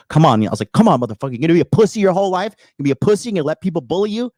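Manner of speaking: loud, fast goading yell at a sparring partner; trash-talking taunt. Retold or imitated. Coach taunting his intern in sparring, loud fast goading yell. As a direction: bark the taunts to provoke him into hitting back. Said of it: "talking shit".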